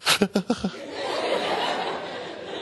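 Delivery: soft, breathy stuttering sobs; imitated sob hiccups. Shown as a demonstration. Kid trying to stop crying, imitated catching sobs. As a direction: gasp in short catches as if stifling tears. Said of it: "you start doing this".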